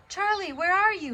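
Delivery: high, loud yell across the garden. A narrator's reading. Tom yelling for Charlie through the garden. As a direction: call out, searching. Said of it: "he yelled".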